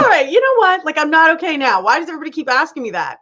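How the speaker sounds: retold irritated rant, fast at the end; mock exasperation. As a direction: play fed-up and snappy at the pesterers. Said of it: "it just makes me mad".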